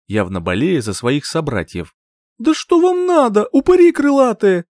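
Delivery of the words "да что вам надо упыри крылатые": high, loud, indignant screech; exasperated yelling. A narrator's reading. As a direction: yell up at pests attacking you. Heroine yelling at attacking gulls.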